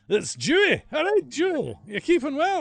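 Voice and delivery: loud, high Scottish holler; big high-pitched Scottish shout; Scottish accent. Pretend. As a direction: call out a greeting in excited Scottish. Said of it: "Apparently I've gone Scottish".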